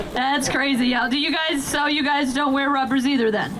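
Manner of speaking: normal talking voice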